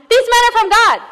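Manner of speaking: high, loud shrieked proclamation; girl screaming again. Retold or imitated. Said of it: "this girl screams again".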